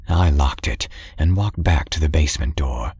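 ordinary delivery, nothing marked

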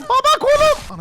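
put-on high, loud child's cry; kid voice. Son cries about his arm, high child voice. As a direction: yelp for dad like a hurt little boy.

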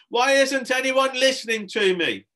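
high, loud tantrum wail; exasperated high shout; crying out. Acted. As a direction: yell it as an ignored child. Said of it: "cried Harrison".

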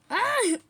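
short high loud cry of fright; sharp scared "ah"; startled yelp. Real, not acted. Jump scare in game.

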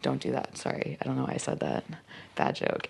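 taking back a bad joke under your breath; embarrassed aside. Real, not acted. Embarrassed aside after stalking joke.

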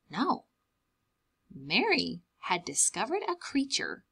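plain reading voice

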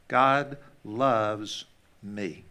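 each word drawn out and set apart; slow, deliberate repeat. Shown as a demonstration. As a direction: say it very slowly, word by word. Repeated slowly for writing down.

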